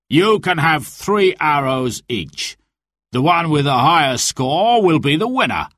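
lightly voiced official announcement; sheriff announcing rules. A narrator's reading. Sheriff tells the archers the rules, announcing. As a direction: proclaim the rules to the crowd. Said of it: "said the sheriff".

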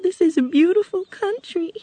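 put-on high, crying little-girl voice; tearful. A narrator's reading. Dorothy begins to cry to the Good Witch, tearful. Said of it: "Dorothy began to cry".

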